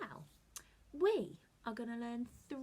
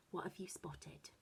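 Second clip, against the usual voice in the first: whisper; 4 semitones lower, 8 dB quieter than usual. Shown as a demonstration. Teacher builds suspense.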